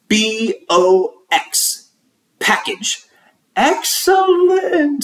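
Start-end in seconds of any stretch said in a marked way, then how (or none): 3.6-5.0 high, slow praise. A narrator's reading. Teacher praises speller.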